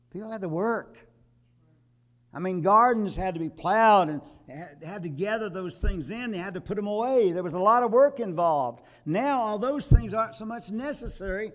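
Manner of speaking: high, loud full-force preaching about hard work; fiery preaching. Acted. Preacher scolds congregation about wasted time, loud fiery preaching.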